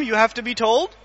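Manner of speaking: ordinary talk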